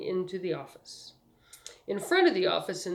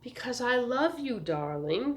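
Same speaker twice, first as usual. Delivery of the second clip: patient, indulgent sweetheart; 5 semitones higher than usual. A narrator's reading. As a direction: humour him patiently, starting high and settling. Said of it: "said very patiently, as if Spangler were a child".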